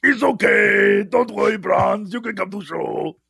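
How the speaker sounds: hammed-up creature reassurance; comic monster voice. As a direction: play a friendly monster soothing a pal. Monster voice reprised as joke.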